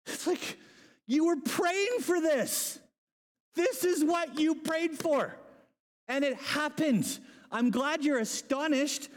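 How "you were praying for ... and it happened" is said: high, drawn-out comic protest; exasperated disbelief. Acted. Preacher to the astonished believers, mock exasperated. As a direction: spell it out slowly in a high voice.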